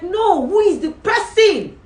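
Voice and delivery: high, loud, slowed royal voice; delighted king. Acted. King praises Esther's feast, high and loud. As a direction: play the king, high and ringing.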